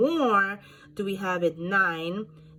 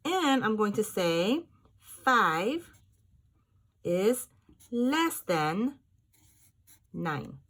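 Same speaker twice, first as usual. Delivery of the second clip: slow, higher and louder; slow dictation; 4 semitones higher, 4 dB louder, slower than usual. Shown as a demonstration. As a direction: spell it out for the class. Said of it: "teacher dictating the symbol".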